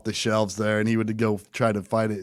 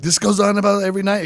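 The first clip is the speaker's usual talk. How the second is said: higher, louder, fast question; higher, louder, fast amazed question; 8 semitones higher, 4 dB louder, faster than usual. Retold or imitated. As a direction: rush it out in amazement. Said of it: "I'm like".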